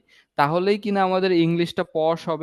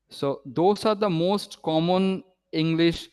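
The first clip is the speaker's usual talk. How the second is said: ordinary delivery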